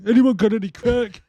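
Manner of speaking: high, loud, strung-out junkie. Retold or imitated. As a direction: beg like a twitchy addict. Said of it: "Gunnar's like".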